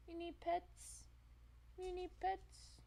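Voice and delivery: high, soft, slowing coo. Real, not acted. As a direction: ask the kitty sweetly, way up high. Baby talk to the cat.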